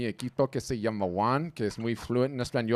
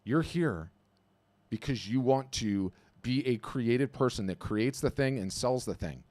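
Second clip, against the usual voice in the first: usual speaking voice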